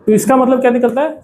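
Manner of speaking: ordinary talk, nothing marked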